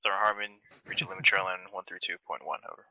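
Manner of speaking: loud, clear radio reply; loud radio call. Real, not acted.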